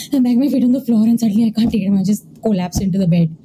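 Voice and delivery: ordinary delivery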